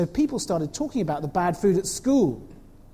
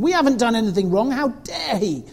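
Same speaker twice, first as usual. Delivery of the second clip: indignant mother; 5 semitones higher, 6 dB louder than usual. Acted. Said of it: "said Mother Ruth to her husband".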